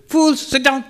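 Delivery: high mock scold with laughter; high-pitched old swami scolding. Old swami to monks rushing off, high laughing scold.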